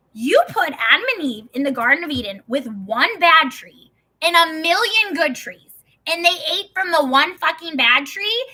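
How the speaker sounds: loud, high exasperated rant; indignant challenge. Retold or imitated. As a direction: hammer out the accusation in disbelief. Indignant challenge to God retold.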